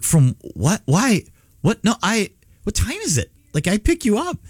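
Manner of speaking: high, rushed panicked questions; panicked confusion. Retold or imitated. Father panics when his son walks in early. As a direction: fire off the questions in alarm. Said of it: "you just get this panic".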